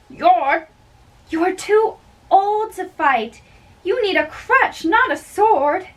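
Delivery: high, scornful teasing; Lady Capulet scorning her old husband's wish to fight; mocking. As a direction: sneer at him for being too old to fight.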